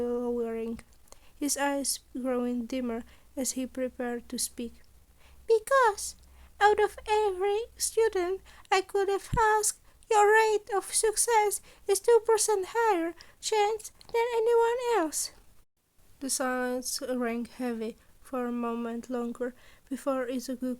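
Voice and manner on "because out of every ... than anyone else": high, louder small-animal principal voice; chirpy high principal voice, pleased with himself; Nezu, the principal. A narrator's reading. Principal offers a student an extra boon, high and bright. Nezu's voice in the story.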